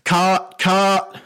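high, loud bellowed command; shouted order from far below; booming voice. Acted. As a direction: boom it out over a whole airfield. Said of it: "you hear a booming voice go".

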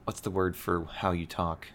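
usual speaking voice

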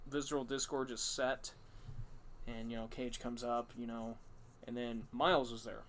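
ordinary delivery

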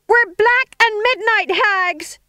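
high, slow yelled chorus; yelling. A narrator's reading. Witches getting into the spirit, yelling. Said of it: "they yelled".

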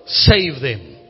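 impassioned pulpit preaching; preaching at full force. Acted. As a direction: build the sermon to a bold climax.

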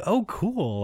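high-pitched "oh", impressed; bright interest. Retold or imitated. Customer hears saleswoman is from Brooklyn, high-pitched interest. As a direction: react with a high, friendly lift.

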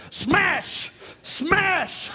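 high-pitched mock battle cry of a little boy; gleeful child's smash. Preacher as four-year-old jumping in puddle, gleeful exclamation. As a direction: punch it out high, like a kid stomping.

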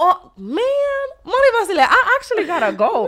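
very high, loud squeal of dismay; hammed-up flustered escape; mock panicked excuse. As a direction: squeal your way out of the call, half laughing. Acting out panicked exit from a call.

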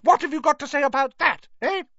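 high, loud and fast demand; angry demand. Acted. Molly demanding an answer from Arthur, loud and fast. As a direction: hammer the question at him. Wife continues shouting.

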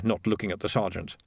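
ordinary delivery, nothing marked

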